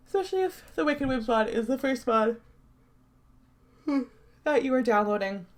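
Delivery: yawning through the words; tired yawning. Real, not acted. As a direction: talk mid-yawn, sleepy. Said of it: "Yes, I'm tired. I'm gonna keep yawning".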